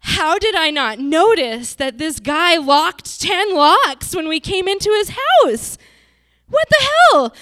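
finding ten locked locks, thinking in rising panic. Retold or imitated. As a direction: spiral into alarm, ending in a near shriek. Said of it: "And I'm thinking".

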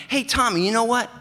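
high, loud, eager friend's voice; Brian's eager voice. Retold or imitated. Brian greets his mail carrier, high and loud. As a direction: pitch it way up and call out to your old buddy. Brian about to share news.

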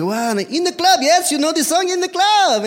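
very high, loud, hammed-up enthusiasm; excited fans. Young locals greeting the traveller with a song, mock excitement.

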